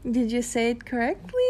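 ordinary talk, nothing marked

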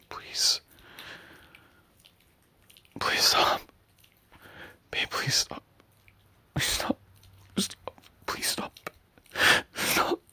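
frightened, whispered begging; desperate hushed pleas; pleading whisper. Acted. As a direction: beg in a shaky whisper.

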